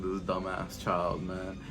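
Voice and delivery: low, soft, slow grumble; imitated father's low dismissive aside; unbothered dad. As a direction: mutter it slowly as a dad who doesn't care. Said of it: "He was just like".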